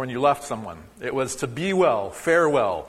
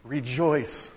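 solemn greeting; much slower than usual. Retold or imitated. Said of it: "they would say".

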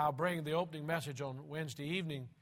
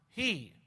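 "he" lifted and punched; stress on he; 3 semitones higher than usual. Shown as a demonstration. Preacher showing congregation the stress, "he" stressed. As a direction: hit the word "he". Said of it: "emphasis placed on the word".

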